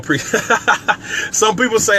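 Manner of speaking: ordinary delivery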